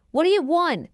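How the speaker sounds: high, irritated challenge; annoyed demand. Acted. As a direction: snap at an unwelcome ex, acted. Confronting cheating boyfriend at window.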